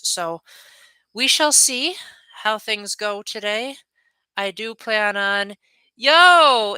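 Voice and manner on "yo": sudden loud, high greeting; a real burst of recognition; excited yo. Real, not acted. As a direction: call out in delighted surprise. Streamer spots a returning viewer.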